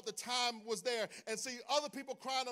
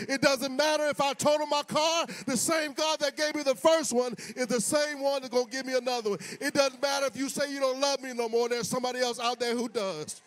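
loud, punching preacher's cadence; full-force preaching; 3 semitones higher, 14 dB louder than usual. Acted. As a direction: hammer each line home.

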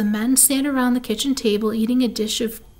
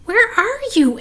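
high-pitched, searching question; puzzled young pig; 10 semitones higher, 4 dB louder than usual. A narrator's reading. Wilbur searching for Charlotte.

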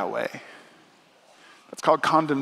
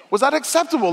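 pitched up high in mock disbelief; rising incredulous question; 10 semitones higher, faster than usual. Shown as a demonstration. Preacher teasing parents about baby talk, high incredulous question. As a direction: ask it high, teasing the crowd. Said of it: "Uh, was that acceptable?".

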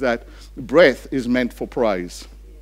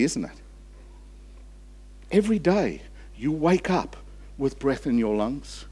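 normal talking voice

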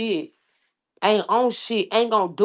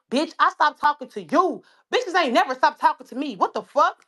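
angry; 6 semitones higher than usual. Real, not acted. Heated rant at rivals.

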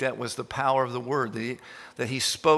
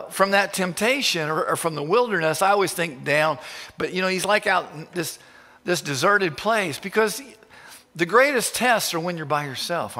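ordinary delivery

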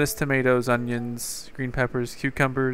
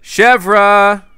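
high loud shout; 6 semitones higher, 11 dB louder than usual. Real, not acted. Shouting for the missing villager, higher and louder. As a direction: yell her name out high.

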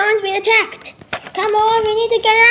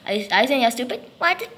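ordinary talk, nothing marked

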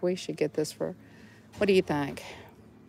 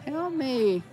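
trapped figure; 6 semitones higher than usual. Pretend. Voicing a figure in a glow ball.

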